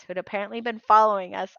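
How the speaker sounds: usual speaking voice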